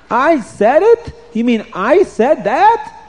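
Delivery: high, slow, aghast; disbelieving king. Acted. King learns he ordered it, high and slow. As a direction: ask it slowly in horror.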